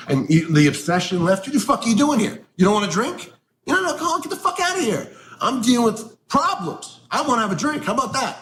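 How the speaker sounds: high-pitched, mock-furious retort; angry rant. Venting at smug old timers in meetings, angry high-pitched rant. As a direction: rail at them, pitch climbing high. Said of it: "And I would get so angry".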